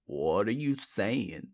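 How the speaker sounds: low, slow, soft question; stunned Cyrus. A narrator's reading. Cyrus questions his wife, low and slow. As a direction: ask it deep and hushed. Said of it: "Cyrus said, talking to his wife".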